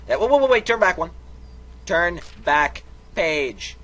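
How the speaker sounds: loud, high, hurried halt; urgent stop. Real, not acted. Reader urgently asks to turn back, loud and high. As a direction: stop them before they miss it. Urgently stopping page turning.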